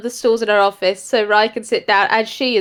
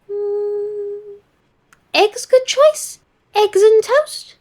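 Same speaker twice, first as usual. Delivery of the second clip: higher, chirpy broken-English praise; a cheerful little house spirit cooing; chirpy spirit voice praising the tea; high sing-song spirit creature; 10 semitones higher, much slower than usual. Acted. Network voice.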